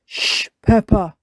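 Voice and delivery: loud shushing; Daddy Pig. Acted. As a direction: shush a noisy child firmly. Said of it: "says Daddy Pig".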